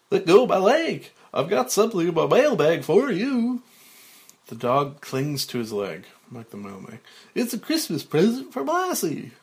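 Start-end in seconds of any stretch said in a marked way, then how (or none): 0.1-3.6 loud, high, hammed-up kids' show host; big cartoon voice, pitched way up; cartoonish mailman. Mike the mailman to the dog, loud cartoonish kids' show voice. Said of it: "at a cartoonish Mike the Mailman". 7.3-9.3 loud, high, hammed-up kids' show host; big cartoon voice, pitched way up; cartoonish mailman. Mike the mailman to the dog, loud cartoonish kids' show voice. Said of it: "at a cartoonish Mike the Mailman".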